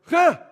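loud high huff of frustration; exasperated huff. Acted. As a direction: huff it out, fed up. Moses exasperated.

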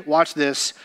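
ordinary delivery

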